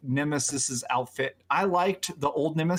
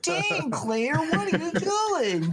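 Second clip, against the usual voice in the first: put-on high, whiny young man; 13 semitones higher, much slower than usual. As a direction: whine it like a bad game actor. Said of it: "maybe don't give him the whiny voice of".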